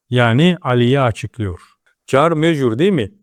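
ordinary talk, nothing marked

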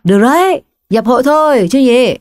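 high, loud party shout; Hùng shouting over the music, high and loud; loud cheer. Acted. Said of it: "lên hét lớn".

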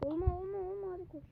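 high-pitched, anxious urging; panicked pleading. Real, not acted. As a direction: plead under pressure, pitch jumping up. Urging self through tense game moment. (panikle yalvarır gibi)

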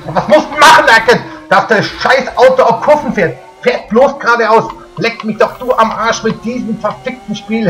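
screaming rage at the game; berserk rage. Real, not acted. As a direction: yell it, completely losing it. Raging gamer losing a race.

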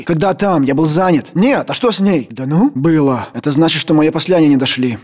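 ordinary talk

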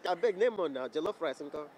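light Nigerian pidgin lilt; Nigerian pidgin accent. Pretend. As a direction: slip into a West African pidgin accent. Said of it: "let's have this interview in, like, African accent".

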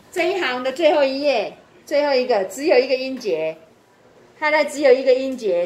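loud, emphatic classroom instruction. Real, not acted. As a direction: project the rule firmly to the whole class. Teacher stressing a rule to class.

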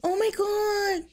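slow high oh my God; drawn out in shock; stunned. Real, not acted. Viewer watching the breakup scene, slow stunned gasp. Reacting to the drama.

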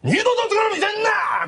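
high, slow mock bellow; imitated angry shout. Boyfriend shouts at the girlfriend before leaving, mock bellow. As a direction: bark it like the storming boyfriend. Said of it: "その声でびっくりして".

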